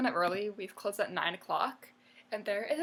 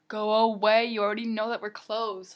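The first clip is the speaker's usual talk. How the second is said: shouted, fed up go away; furious waitress yelling; loud exasperated; 9 dB louder than usual. Real, not acted. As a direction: blast it at them. Ranting at customers.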